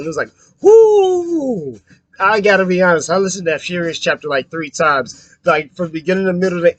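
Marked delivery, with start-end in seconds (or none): 0.6-1.8 wild whoo. Retold or imitated. Imitating character's wild cry.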